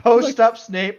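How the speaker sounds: mock challenge. Joking about Ron facing Snape, loud mock taunt. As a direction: taunt Snape like a playground dare.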